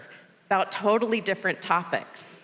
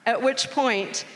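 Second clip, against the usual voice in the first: normal talking voice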